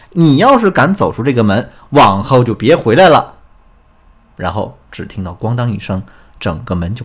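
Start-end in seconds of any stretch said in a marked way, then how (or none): 0.1-3.3 acted angry ultimatum, raised and loud; fierce shout. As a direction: shout it after him, slowing on the threat. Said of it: "狠狠地冲他喊了一句". (模仿妻子狠狠地喊)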